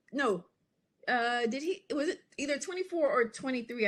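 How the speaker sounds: normal talking voice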